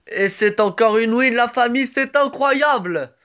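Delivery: high, loud yell, rising and stretched out; real triumphant scream to the fans; excited victory shout. Real, not acted. Gamer celebrating another win with his viewers, shouting. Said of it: "Désolé quand je crie".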